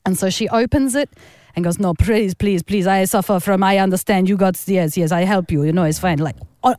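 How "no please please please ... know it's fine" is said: imitating a Russian mum, pleading; mock Russian accent, insistent please; lightly hammed Russian accent. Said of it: "how good I'm doing this accent".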